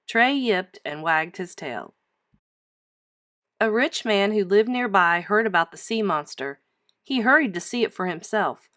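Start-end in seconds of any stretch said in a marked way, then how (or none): none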